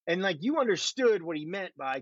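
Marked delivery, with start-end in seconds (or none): none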